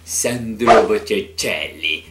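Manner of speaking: slow, loud, exaggerated Italian accent. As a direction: stretch the name out like a hammy Italian. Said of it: "sem fazer esse sotaque de italiano macarrone".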